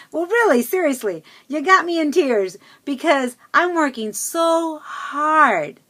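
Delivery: high, loud insistence, drawing out the last words; emphatic retold plea. Telling friend she made her cry, a high, loud earnest plea.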